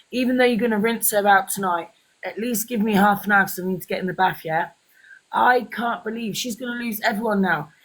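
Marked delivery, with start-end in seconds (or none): none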